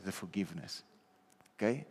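ordinary talk, nothing marked

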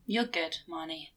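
gentle comfort. Acted. Junimo comforting Marnie.

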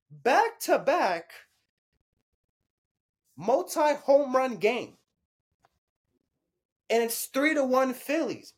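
high-pitched, emphatic sports hype; hyped disbelief. Real, not acted. Fan reacting to two home runs today, hyped. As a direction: marvel at each stat in high, punched bursts.

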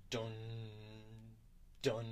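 drawn-out gong strikes with a pause between; imitated gong. Game master sounds a gong banging outside, imitated gong. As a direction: sound out a slow, tolling gong. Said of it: "you hear a loud gong".

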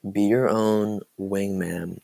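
normal talking voice

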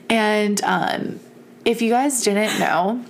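ordinary talk

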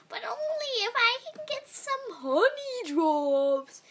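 breathy, pesky little-sister whine; annoying voice. A narrator's reading. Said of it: "in the most annoying voice she could muster".

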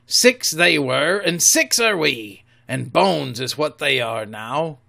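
a rough sea cook unnerved by Flint; loud old pirate voice, uneasy; pirate Silver, spooked. Acted. Silver speaks.

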